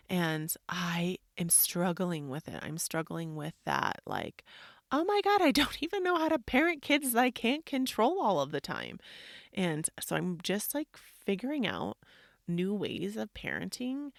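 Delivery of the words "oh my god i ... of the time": high, loud wail of parental despair; exasperated overwhelm. Retold or imitated. Mom voicing her struggle to parent, exasperated. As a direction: wail it as if at a loss.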